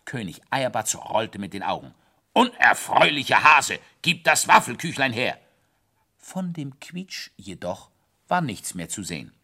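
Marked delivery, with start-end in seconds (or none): 2.3-5.4 loud, bossy demand; angry royal command. Acted. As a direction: shout at the hare to hand it over. King demands waffle.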